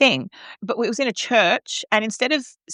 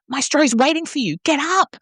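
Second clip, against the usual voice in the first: high, pushy stage whisper at her; a colleague urging her to stand, in a mock urgent whisper; imitated urgent whisper; 8 semitones higher than usual. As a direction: hiss it urgently right at her. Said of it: "Like this, like, whispering".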